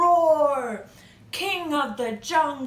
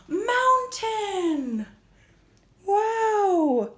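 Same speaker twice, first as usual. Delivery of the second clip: high, drawn-out wonder; awed reveal; 7 semitones higher, much slower than usual. Pretend. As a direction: announce it with big amazement. Revealing mountain pose to children.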